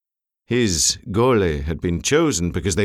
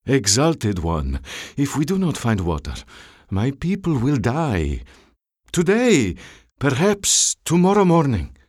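deferential officer, pleading; 4 semitones higher than usual. A narrator's reading. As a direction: press an urgent case to a dangerous superior.

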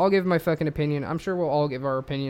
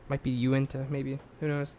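ordinary talk

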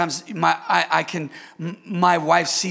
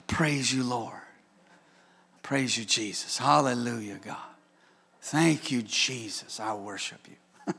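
softer, lower murmured worship; hushed prayer; 7 semitones lower, 6 dB quieter than usual. Retold or imitated. Pastor reenacting private worship, hushed lower prayer. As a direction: pray it quietly to yourself.

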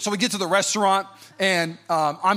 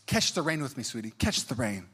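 soft, low coaxing; gently coax, as to a small child; soothing mom, imitated; 6 semitones lower, 7 dB quieter than usual. Mom calming her screaming girl, imitated soft soothing.

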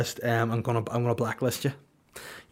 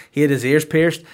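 ordinary talk

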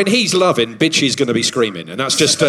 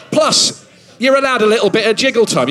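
excited outburst; 8 semitones higher than usual. Real, not acted.

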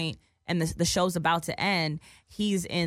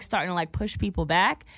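normal talking voice

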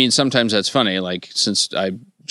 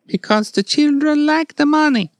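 very high, louder squeak; high-pitched fairy explaining the rules; shrill fairy voice; 4 dB louder than usual. Acted. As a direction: chirp it like a shrill tooth fairy. Jokes in the fairy voice.

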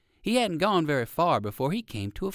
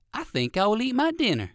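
put-on higher, polite old gentleman rabbit; 8 semitones higher than usual. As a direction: decline kindly as an old rabbit. Uncle Wiggily to himself.

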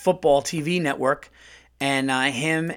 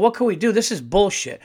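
indignant retort; 6 semitones higher than usual. Retold or imitated. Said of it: "And I'm going".

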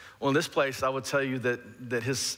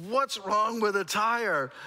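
shouted confused question; 9 semitones higher, slower than usual. Retold or imitated. Confused reply to shouting mechanic.

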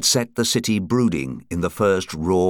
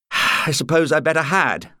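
reluctant agreement; 8 semitones higher than usual. A narrator's reading. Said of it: "Beattie frowned. He was enjoying his game".

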